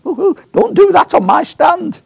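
high shrill mock alarm, loud; excited squeal. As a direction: squeal it like a startled lady. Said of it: "and she went".

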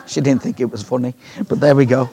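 ordinary talk

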